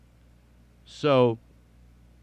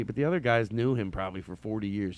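usual speaking voice

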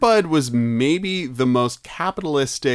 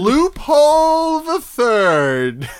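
slow, higher, stretched announcer voice; movie trailer title; 9 semitones higher, 3 dB louder, much slower than usual. Pretend. Friend pitches the episode title as a grand announcer, slow and stretched. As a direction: roll it out like an epic title card.

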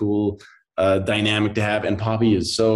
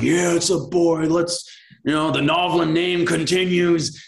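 mock macho cheer; 7 semitones higher than usual. Imagined macho dad cheer.

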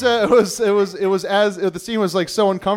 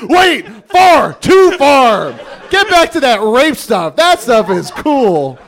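loud, high crowd yelling; mock crowd shout; 7 semitones higher, 7 dB louder, much slower than usual. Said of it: "everyone's like".

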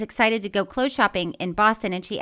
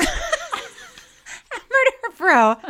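high, loud, drawn-out laughing words; 5 dB louder, much slower than usual. Real, not acted. Host echoing co-host's title for her, laughing. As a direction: squeal it out slowly through laughter.

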